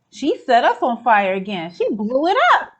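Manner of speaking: ordinary talk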